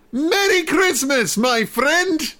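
high, mock German Red Baron voice; faux German accent. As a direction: say it like a hammed-up German flying ace. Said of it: "in sort of a faux German Kim dot com sort of accent".

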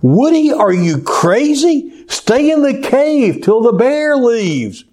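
high-pitched frantic shout from a tree; frantic call. Acted. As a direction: call out to a friend in mock panic. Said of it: "frantically called out".

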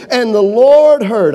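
"And the Lord heard." slow, every word weighted, loud; emphatic slow statement. Shown as a demonstration. Preacher driving home that God heard, slow and loud. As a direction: lean on "Lord" and draw out "And the Lord heard.". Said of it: "I want you to get that".

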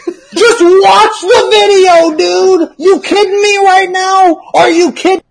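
high-pitched laughing disbelief; exasperated laughing outburst. Real, not acted. Exasperated reaction to comment.

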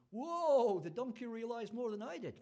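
a loud, high "whoa" of shock; startled realization. Acted. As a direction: gasp it out as if you just saw an angel. Balaam realizes the supernatural.

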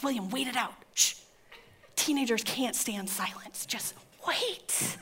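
conspiratorial undertone fading to whisper; hushed instruction. Acted. Wife coaching husband to stay silent, hushed. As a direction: keep him waiting without being heard. Hushing husband on open line.

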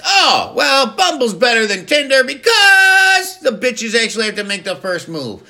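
very high, loud, frantic sponsor pitch; over-the-top shrieked parody commercial; screaming mock ad read. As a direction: yell the whole advert at top pitch. Performs shouted parody sponsor read.

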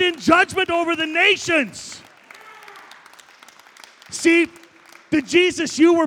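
high, loud, thundering proclamation; full-voiced revival preacher roar; preaching at full force. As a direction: shout the triumphant return over the church.

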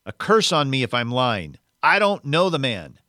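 loud, vehement denial, rising in pitch; swearing an oath. A narrator's reading. Peter swearing to bystanders he doesn't know Jesus, vehement. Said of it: "And Peter swore again".

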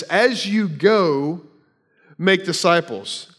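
As you go, slowed and drawn out, then make disciples; slow emphatic reading. Shown as a demonstration. Preacher reads the verse slowly to the congregation, emphatic. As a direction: stretch out As you go, lean on make. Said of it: "the sentence actually reads like this".

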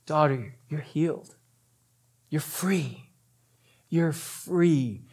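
soft, unhurried reassurance; tender smile. Acted. Jesus telling the scared woman she is healed, tender. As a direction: release them gently, with a smile. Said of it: "I see the smile in his eyes".

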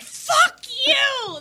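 high mock yell; imitating a cussing sister. Restaurant sister cussing out her sibling, high mock yell. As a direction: squeal it like the angry sister. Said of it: "totally cussing each other out".

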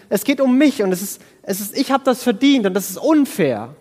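imitating a self-righteous whine about unfairness; whiny entitlement. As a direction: whine that you deserve it. Voicing the self-righteous complaint.

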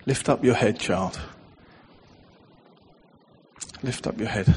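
hushed fatherly call; Father's tender voice. Retold or imitated. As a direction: soothe the listener into looking up. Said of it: "as if it was the Father saying it".

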